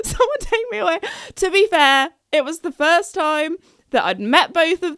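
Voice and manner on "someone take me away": laughing repeat. Retold or imitated.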